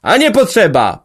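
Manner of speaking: loud, high echo of the crowd; chorus of agreement. A narrator's reading. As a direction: shout it back together. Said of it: "powtórzyli inni chórem".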